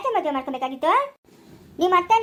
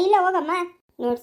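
ordinary talk

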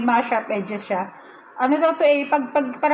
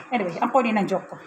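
normal talking voice

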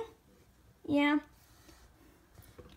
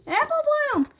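short, high, scolding holler; Applejack; 9 semitones higher, 5 dB louder, faster than usual. Acted. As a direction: call out the name loudly without stretching it. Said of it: "that was a nice impression. That wasn't me".

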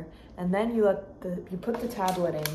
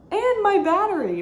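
exasperated rant; 12 semitones higher, 9 dB louder than usual. Real, not acted. Ranting about phone trouble.

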